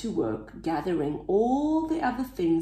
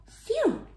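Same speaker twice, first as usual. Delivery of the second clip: relieved phew. A narrator's reading. Relief after hard work.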